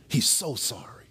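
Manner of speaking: soft unvoiced whisper of an apology. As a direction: breathe it out like a hushed, sorry aside. Assistant's apology relayed softly.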